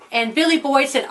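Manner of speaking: plain reading voice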